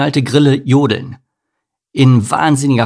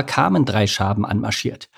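ordinary delivery

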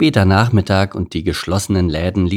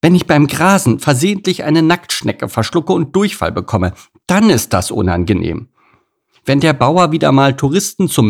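high-pitched indignant rant; outraged, dramatic cow; 8 semitones higher, 4 dB louder than usual. A narrator's reading. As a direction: rant in outrage, building to melodramatic despair.

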